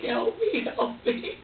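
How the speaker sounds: high, breathy little plea voiced for the baby; pleading baby's look. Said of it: "he's just looking at me like".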